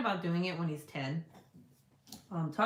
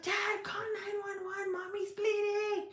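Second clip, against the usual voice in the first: put-on panicked little boy; 14 semitones higher than usual. Son voiced in story.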